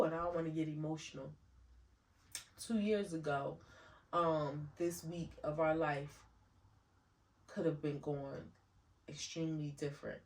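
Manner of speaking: soft, quiet voice near tears; emotional tribute to husband, softly choked up. Real, not acted. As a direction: hold back tears while thanking him. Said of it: "my throat is, like, swelling up".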